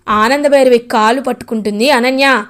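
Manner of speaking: ordinary delivery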